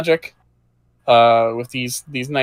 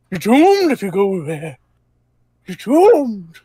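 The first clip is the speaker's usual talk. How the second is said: put-on rising, higher doom-laden old man; 7 semitones higher than usual. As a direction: wail the doom like a prophet. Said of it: "Just to add some flavor".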